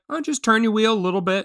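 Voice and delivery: high, quick, gentle coaching; kindly grandpa. Retold or imitated. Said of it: "just kind of very kindly".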